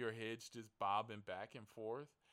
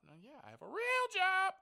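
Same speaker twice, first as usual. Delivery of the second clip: much higher and louder, proudly hammed up; overblown real job boast; 14 dB louder than usual. To the blind man, bragging loudly about his real job. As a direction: trumpet it like a big announcement. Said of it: "You gotta say it like that?".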